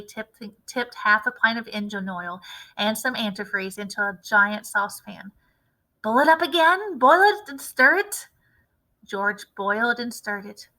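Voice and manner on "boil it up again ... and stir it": higher, louder eager dad; excited father voice. A narrator's reading. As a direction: push it eagerly, a bit pushy. Mr Cranky excited about the medicine.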